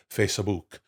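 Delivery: Facebook said Italian-style as "face-a-book"; Facebook in three syllables with an Italian accent; Italian pronunciation. Shown as a demonstration. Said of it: "as they call it in Italy".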